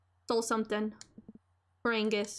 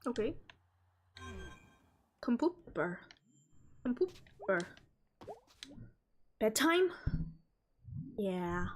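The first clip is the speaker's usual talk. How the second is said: usual speaking voice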